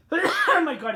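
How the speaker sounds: loud laughing oh my God; real laughter; laughing after coughing. Real, not acted. Coughing fit then laughing.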